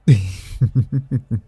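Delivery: rippling run of chuckles; teasing laugh. Acted. Sibling teasing brother about a nickname, amused laugh. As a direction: laugh at his expense.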